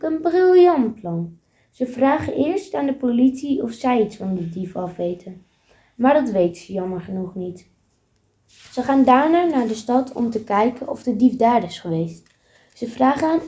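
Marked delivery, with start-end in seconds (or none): none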